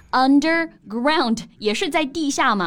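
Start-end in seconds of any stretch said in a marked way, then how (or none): 0.1-1.4 underground stretched out very slowly; teacher slowly modelling underground; slow model word. Shown as a demonstration. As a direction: spell the sound of underground out slowly for learners. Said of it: "这个词也很好理解啊".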